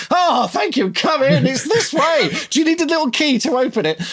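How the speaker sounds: breathless, over-eager host voice; gushing welcome. Retold or imitated. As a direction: greet the meter reader like a long-lost lover. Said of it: "I greeted him like a long lost lover".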